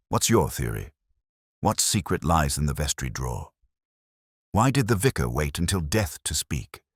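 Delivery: ordinary delivery, nothing marked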